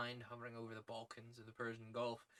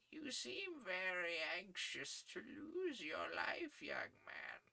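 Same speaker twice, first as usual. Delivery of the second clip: put-on high, thin voice of a kindly old editor; 8 semitones higher, 4 dB quieter, slower than usual. Editor praising young Malone's articles, high old-man voice. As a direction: pitch him up as a fussy old newsman.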